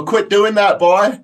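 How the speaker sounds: a guard barking at a noisy prisoner; cop scolding. Retold or imitated.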